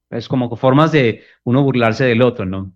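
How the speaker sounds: usual speaking voice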